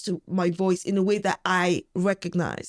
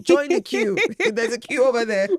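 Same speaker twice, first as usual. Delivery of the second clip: louder, higher brush-off with a laugh; playful, emphatic send-off; sassy dismissal; 4 semitones higher, 4 dB louder than usual. Acted. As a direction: send the haters packing, amused. Sassy brush-off of online haters.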